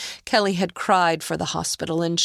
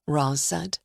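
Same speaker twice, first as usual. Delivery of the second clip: ordinary delivery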